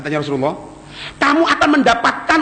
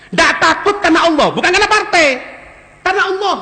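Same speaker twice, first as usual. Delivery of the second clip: fiery preaching; 6 semitones higher, faster than usual. Real, not acted. Preaching at full force.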